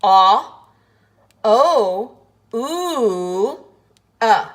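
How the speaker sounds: loud, long drawn ah, O, ooh, uh; vowel sounds of O. Shown as a demonstration. Teacher sounding out the four sounds of O. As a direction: sound out each vowel of O slowly. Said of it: "Let's just do the sounds again".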